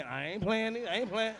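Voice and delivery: usual speaking voice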